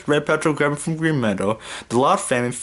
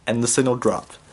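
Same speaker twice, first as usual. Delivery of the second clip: ordinary delivery, nothing marked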